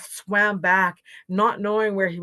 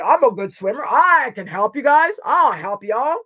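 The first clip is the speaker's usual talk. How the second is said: loud, hearty bear offer; confident bear; 4 semitones higher, 10 dB louder than usual. A narrator's reading. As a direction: announce yourself boldly. Polar Bear boasts and offers help.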